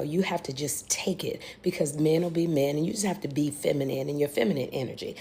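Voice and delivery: soft hushed whisper. Retold or imitated. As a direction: whisper it like a secret. Retold advice whispered.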